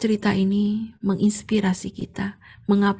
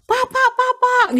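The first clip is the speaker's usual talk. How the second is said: high, slow, urgent calls at the door; panicked calling; 4 dB louder, slower than usual. Retold or imitated. Neighbour banging on the door at dawn, panicked calling. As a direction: call out in a panic, much higher. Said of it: "kayak orang panik gitu".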